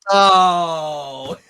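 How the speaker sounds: loud long burst of laughter; big real laugh. Real, not acted. Host laughing at the guest's joke, loud laugh. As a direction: crack up at the joke.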